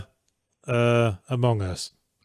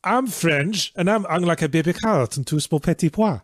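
put-on comic Frenchman's accent, pitched up towards the end; French accent; 3 semitones higher than usual. Quoting a Blackadder line about French lovers, hammed-up French accent. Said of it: "said in a French accent".

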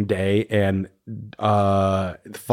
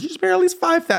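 much higher, blurted number; Ramona blurting; much faster than usual. Retold or imitated. Ramona throwing random numbers at Luann, in a high blurt. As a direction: blurt out a figure. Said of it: "Ramona's just throwing out random numbers".

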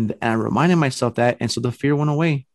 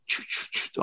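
soft breathy clicks with no voice; camera shutter noise; 10 dB quieter than usual. Retold or imitated. Friend snapping photos in the hallway, whispered shutter clicks. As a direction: mimic a camera firing over and over. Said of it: "all this flashing and going off".

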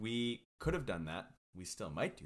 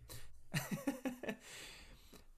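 high breathy laugh; 6 dB quieter than usual. Real, not acted. Breaks into laughter.

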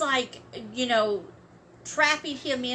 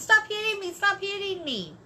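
high-pitched mock scream, held back in volume; mild, high imitation of screaming; retold scream; 8 semitones higher than usual. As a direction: imitate screaming for help without full force. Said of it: "starts screaming to the top of her lungs".

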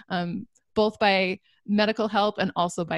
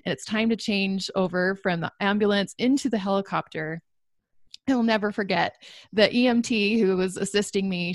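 ordinary talk

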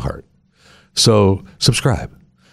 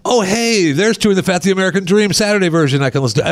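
high, chirpy mock delight; imitating a happy listener; 11 semitones higher than usual. Host imitates a subscriber getting podcast alerts, high mock delight. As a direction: play the eager fan spotting new episodes. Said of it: "And you go".

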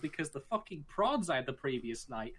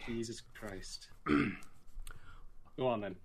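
soft, slow exasperated mutter; exasperated whisper; 6 semitones lower, 5 dB quieter, much slower than usual. Pretend. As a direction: sigh it out under your breath.

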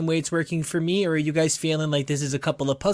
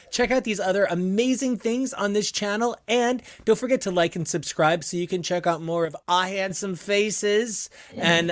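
ordinary talk

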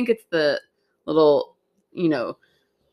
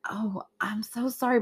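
slow hushed apology; whisper; 3 semitones higher, 7 dB quieter than usual. Retold or imitated.

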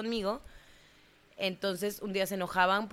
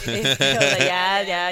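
real laugh, louder; laughing through words; 11 dB louder, much slower than usual. Real, not acted. Laughs after nickname.

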